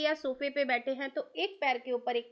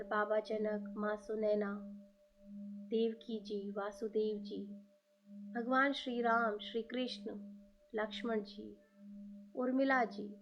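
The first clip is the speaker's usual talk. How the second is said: guided meditation; 2 semitones lower than usual. Acted. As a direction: lead listeners gently inward. Guided meditation invoking gurus.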